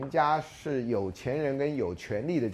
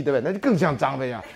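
usual speaking voice

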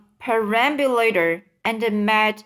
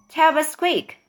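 higher-pitched, impatient call; crying out; 6 semitones higher than usual. A narrator's reading. Earthworm hurrying James, crying out. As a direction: press urgently for an answer. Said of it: "cried the earthworm".

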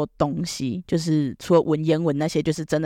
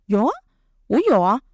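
defensive insisting; 5 semitones higher than usual. Retold or imitated. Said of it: "我说".

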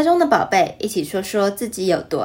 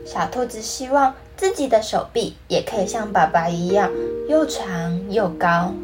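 put-on reading to a toddler; 2 semitones higher than usual. Storytelling narration with character voices.

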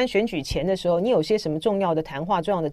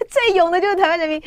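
high-pitched, laughing mock praise; amused, sarcastic cheer; mocking laughter; 10 semitones higher, 4 dB louder, faster than usual. Real, not acted. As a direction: laugh it out, quick and high, teasing. Jokingly hails fearless Taiwanese.

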